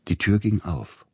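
ordinary delivery, nothing marked

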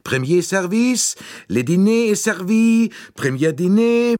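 high, loud sing-song French dining-car call; calling waiter. A narrator's reading. Train steward calling passengers to dinner, sing-song. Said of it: "die auffordernd rief".

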